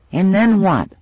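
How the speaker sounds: put-on high, loud little-girl demand; a six-year-old insisting; demanding child. A narrator's reading. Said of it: "demanded the suspect".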